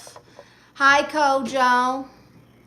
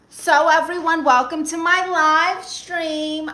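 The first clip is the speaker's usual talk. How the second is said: excited host welcome; 6 semitones higher, 7 dB louder than usual. Real, not acted. Loud livestream welcome.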